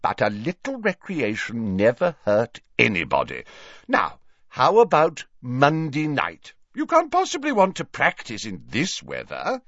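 high, hearty professor coaxing; theatrical, insistent old host; jovial, cajoling Slughorn. Acted. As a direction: coax him grandly, drawing out the offer.

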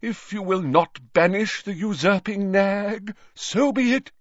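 high-pitched, affronted declamation; deeply wounded. A narrator's reading. Trelawney protests the usurping nag, deeply wounded. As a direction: make a show of injured dignity. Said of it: "in a deeply wounded voice".

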